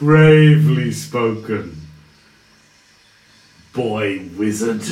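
lightly voiced mocking villain. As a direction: sneer a little at the boy wizard.

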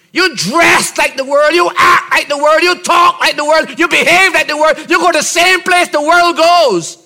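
high, loud preaching building to a shout; impassioned sermon, pitched high and climbing; full-force preaching. Real, not acted. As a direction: drive each phrase harder, up to a shouted command.

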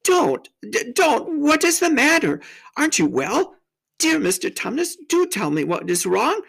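high, stammering alarm; high-pitched worried questions; in great distress. A narrator's reading. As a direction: plead anxiously as young Lucy. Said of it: "said Lucy in great distress".

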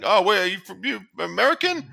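high, rising mock question of a curious driver; curious cabbie asks where she's from, high and amazed; mock surprised driver. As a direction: play a nosy driver who can't place her accent. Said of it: "they're like".

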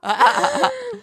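high-pitched burst of laughter; laughing. Real, not acted. Host cracks up at the husband reveal, laughing.

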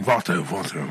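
low put-on Mexican accent; playful Mexican-accented warm-up word. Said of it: "I gotta do Mexican".

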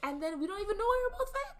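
very high, loud and fast; comic exasperation. Real, not acted. Wife sums up the pointless quarrel with husband, high exasperation. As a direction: squeal it out in baffled exasperation.

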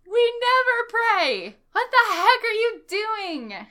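high, loud, slow indignant cry; mock outrage. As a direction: shout it, outraged and drawn out. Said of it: "And it's like".

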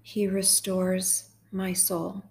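slow, measured psalm reading with long pauses; guided meditation. Acted. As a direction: read it line by line, unhurried, leaving room to repeat.

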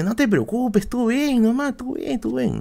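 high-pitched friend coaxing him along; reassuring friend. Retold or imitated. Friend on the phone urging him to come, high coaxing voice.

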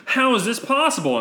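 bald Jedi. Acted. Bald Jedi sees the temple burning, high alarmed voice. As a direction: cry out in disbelief. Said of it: "this bald fellow goes".

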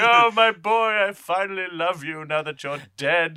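loud, high, drawn-out mock wail; hammed-up fatherly lament; mock grieving father. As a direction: wail it out in overblown grief. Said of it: "And he's like".

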